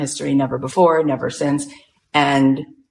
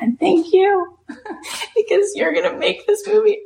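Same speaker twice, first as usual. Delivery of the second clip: very high, choked-up thanks; imitated tearful gratitude. Thanking someone for making this movie, imitated tearful gratitude. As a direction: squeak out thanks as if overcome.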